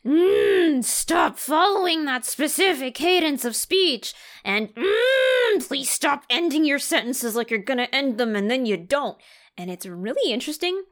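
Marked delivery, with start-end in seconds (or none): 0.0-4.1 high, loud, fussy director voice; high mock director tut; inner voiceover director. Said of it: "the voiceover director that lives in the back of my head rent-free goes". 4.7-9.2 very high "Mm" then fussy correction; high, loud mock director note; inner voiceover director. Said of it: "the voiceover director that lives in the back of my head rent-free goes".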